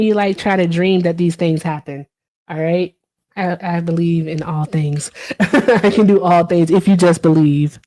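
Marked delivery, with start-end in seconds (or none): 5.4-7.9 giggling through a playful creed; laughing. Real, not acted. Streamer laughing at her own joke to chat. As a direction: laugh while you say it. Joking about believing in dreams.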